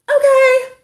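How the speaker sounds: high, loud kid's holler, imitated; child calling out. Five-year-old to his mother before running off, calling out. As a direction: call it out like a satisfied boy far off. Boy calls, then runs off.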